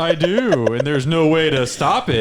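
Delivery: high, loud mocking villain. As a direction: taunt him, high and loud. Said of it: "He's like".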